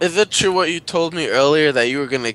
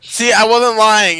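high, loud and slow I-told-you-so; triumphant; 7 semitones higher, 7 dB louder than usual. Real, not acted. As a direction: gloat that you were right. Vindicated outburst.

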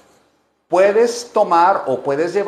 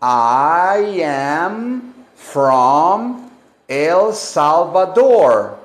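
I am from El Salvador drawn out slowly, louder at the end; very slow model answer; 3 dB louder, much slower than usual. Shown as a demonstration. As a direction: spell out the answer slowly for learners. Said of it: "Y contestamos".

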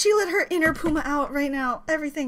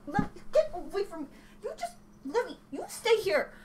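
hushed to cat; 2 semitones higher, 14 dB quieter than usual. Real, not acted. Shooing her cat away.

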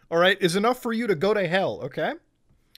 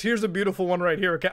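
normal talking voice

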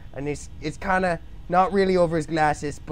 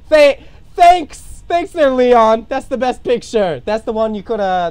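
loud mock thanks; 6 semitones higher, 8 dB louder than usual. Real, not acted. Shouted sarcastic thanks mid-drawing.

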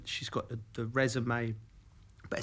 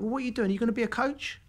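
much higher, pushy imitation of Emma; pressing question; 10 semitones higher, faster than usual. Emma pressing Joe about coaching, higher and pushy. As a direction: push him for an answer. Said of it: "And she went".

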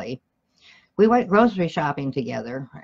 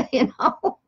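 laughing; 7 semitones higher than usual. Real, not acted. Laughs at his own joke.

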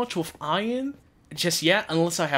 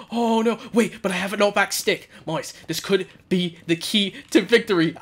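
ordinary talk, nothing marked

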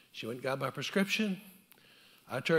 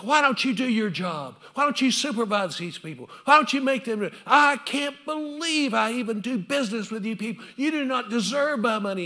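loud, higher angry scolding; chewing out; 8 semitones higher, 8 dB louder than usual. Retold or imitated. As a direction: tear into the pharmacist. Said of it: "he just chewed everybody out".

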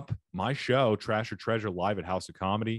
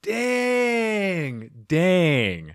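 stunned dang; 8 semitones higher, 3 dB louder than usual. Real, not acted. Reacting to the baby video story.

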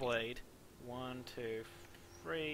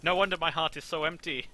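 melodramatic; 3 semitones higher, 10 dB louder than usual. Pretend. Melodramatic parody dialogue.

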